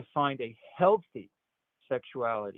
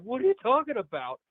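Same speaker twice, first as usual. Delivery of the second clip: imitated shocked objection; 10 semitones higher, 3 dB louder than usual. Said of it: "He's like".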